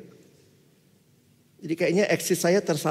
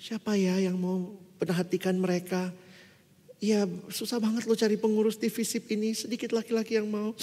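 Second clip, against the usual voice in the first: imitated plaintive appeal; 3 semitones higher, 6 dB quieter than usual. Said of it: "waktu kakak itu bilang begitu ya".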